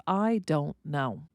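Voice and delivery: "I don't know" said slowly, each word separate and clear; articulated words. Shown as a demonstration. Teacher spelling out what the hum means, slow and clear. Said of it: "it is the universal sound of".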